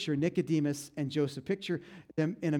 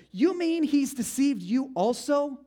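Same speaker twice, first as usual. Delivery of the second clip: slow, high, louder disbelieving retort; sharp retort; 9 semitones higher, 5 dB louder, slower than usual. Acted. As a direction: drag it out in scornful disbelief. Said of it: "The Pharisees retorted".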